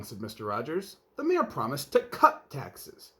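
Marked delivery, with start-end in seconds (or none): 1.2-3.1 complaining. Acted. Husband complaining about mayor.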